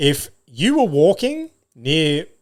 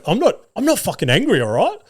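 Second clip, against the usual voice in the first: higher-pitched, indignant mock protest; mock angry outburst; 8 semitones higher than usual. Dad imagines bursting out at the kids, mock indignant. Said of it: "I wanted to burst out there and go".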